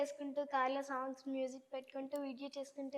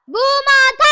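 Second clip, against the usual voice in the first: భూమాత shouted much higher and louder, each syllable stressed; 11 semitones higher, 21 dB louder, much slower than usual. Acted. Teacher showing how stress changes meaning, shouting భూమాత. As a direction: hammer out భూమాత in a high, loud shout. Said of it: "ఒత్తులు మారిపోతాయి".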